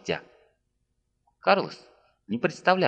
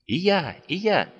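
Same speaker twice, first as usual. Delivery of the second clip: higher, louder eager cries; exclaiming; 6 semitones higher, 3 dB louder than usual. A narrator's reading. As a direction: shout it out one after another. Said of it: "восклицали воины".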